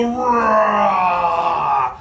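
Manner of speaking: shouted playful bear roar; loud bear roar. Shown as a demonstration. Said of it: "let me hear you go".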